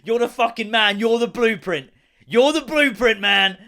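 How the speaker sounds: high, loud hype for the golfer; hyped praise. Retold or imitated. Vlogger hails the golfer as a legend, loud and high. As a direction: cheer him on like a hero. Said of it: "tipped his hat".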